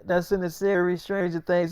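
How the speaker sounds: normal talking voice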